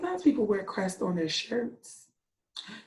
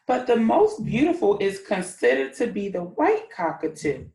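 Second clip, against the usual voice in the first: lively storybook read, a bit louder; reading to children; 5 dB louder than usual. A narrator's reading. Teacher reading a story to her class, animated. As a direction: read the tale brightly to the class.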